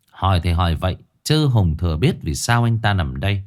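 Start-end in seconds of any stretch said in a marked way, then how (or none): none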